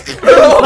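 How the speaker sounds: a very high, loud squeal of laughter. Real, not acted. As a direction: shriek with laughter. Laughs at co-host's answer.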